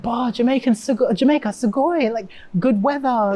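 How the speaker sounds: high-pitched, wowed reaction; playful mock excitement; imitated excited fans. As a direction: gush like an amazed fan. Voicing delighted Japanese fans' reaction.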